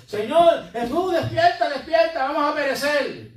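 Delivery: frightened disciples shouting at sleeping Jesus; panicked cry. Acted. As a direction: cry out as if the boat is sinking.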